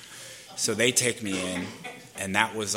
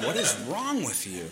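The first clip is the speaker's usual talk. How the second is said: appalled look voiced; 2 semitones higher, 4 dB quieter than usual. Woman at the crash staring at him, appalled. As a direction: voice a disbelieving stare, without raising volume. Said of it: "gives me that look again".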